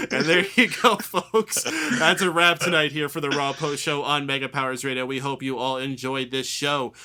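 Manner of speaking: loud radio host sign-off; announcer outro. Acted. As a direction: wrap the show big and loud.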